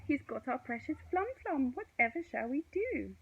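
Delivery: high, worried wail; distressed. A narrator's reading. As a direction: lament the stolen fruit. Stu wails at the theft.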